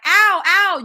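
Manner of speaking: high, loud imitated child's ow. Quoting the girl in the first video, imitated child in pain. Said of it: "when she was like".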